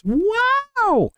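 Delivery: mock offended wow. Mock hurt by chat's jab.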